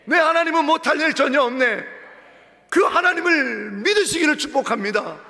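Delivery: preacher at full force; fiery preaching. Acted. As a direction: rouse the church to shout hallelujah.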